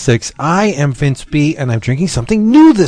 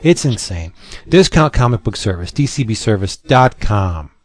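slow, punchy sponsor pitch; hard sell ad; 3 semitones lower, 4 dB quieter than usual. Acted. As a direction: sell it like a radio ad. Sponsor ad read.

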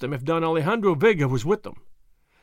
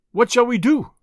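loud, higher eager question; eager cry; 7 semitones higher, 6 dB louder than usual. A narrator's reading. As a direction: caballeros crying out for orders. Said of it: "they cried".

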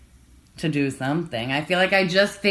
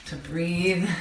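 soft breath; 5 dB quieter than usual. Real, not acted. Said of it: "I'm gonna try to breathe".

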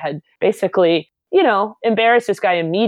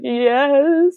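high, wobbly mock-tearful whimper; crying; 9 semitones higher, 3 dB louder than usual. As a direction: imitate a bully reduced to tears. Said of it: "he started crying again".

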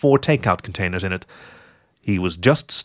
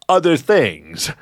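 OTHER THINGS higher and drawn out; evasive hint; 5 semitones higher, much slower than usual. Acted. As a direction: stretch OTHER THINGS suggestively. Fox hints evasively at something.

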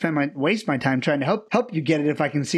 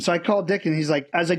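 usual speaking voice

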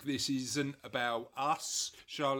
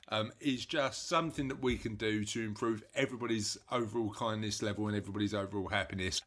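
ordinary delivery

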